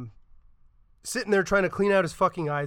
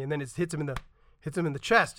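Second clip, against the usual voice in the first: ordinary talk, nothing marked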